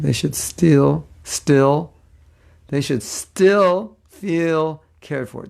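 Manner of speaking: slow, word by word through a tongue twister; deliberately over-articulated, very slow; careful enunciation. Real, not acted. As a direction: stretch out "still" and separate each word. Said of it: "did I say it?".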